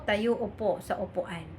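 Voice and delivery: ordinary talk, nothing marked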